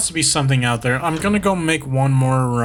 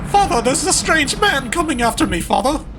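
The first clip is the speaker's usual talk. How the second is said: high, urgent warning cry; panicked warning; 10 semitones higher than usual. Pretend. A son warns his father of a strange man, high and urgent. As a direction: warn him high and fast. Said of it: "Why am I talking like this?".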